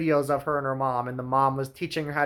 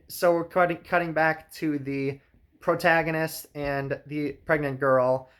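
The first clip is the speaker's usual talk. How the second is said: usual speaking voice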